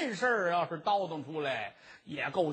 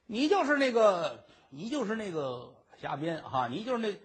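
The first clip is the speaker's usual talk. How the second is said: normal talking voice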